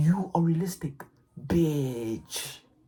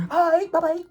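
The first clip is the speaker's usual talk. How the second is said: much higher, hammed-up imitation of a TikTok hustler; high mocking impression voice; mock TikTok guy; 12 semitones higher, 5 dB louder than usual. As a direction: squeak it out like you're mocking him.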